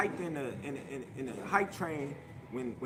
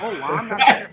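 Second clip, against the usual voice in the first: mocking quote; 4 semitones higher, 14 dB louder than usual. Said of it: "you think".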